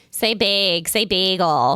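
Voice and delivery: imitating teasers of a Minnesota accent; mock Minnesota accent. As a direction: draw out the Minnesota vowels, teasingly. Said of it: "they were always making fun of".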